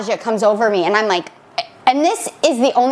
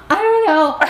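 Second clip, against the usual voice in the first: retold tearful whine; 6 semitones higher than usual. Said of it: "And I'm crying".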